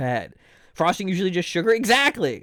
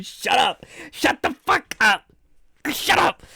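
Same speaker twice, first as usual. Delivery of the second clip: rising playful shut up, higher each time; mock outrage; 6 semitones higher than usual. Streamer jokingly telling chat off, rising mock outrage. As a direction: pretend to be fed up, getting higher.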